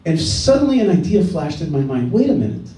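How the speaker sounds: ordinary talk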